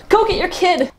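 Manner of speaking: high-pitched cheer urging them on. Retold or imitated. Fan cheering on the heroes at the television, excited cry. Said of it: "screaming and cheering at my television".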